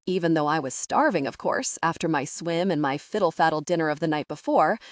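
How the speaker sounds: ordinary delivery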